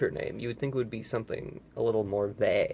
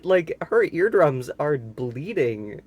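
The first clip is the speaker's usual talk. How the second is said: ordinary delivery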